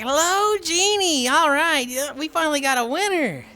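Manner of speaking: put-on high giddy kid asking for wishes; excited kid. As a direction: squeal it like a thrilled little boy. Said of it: "And he's like".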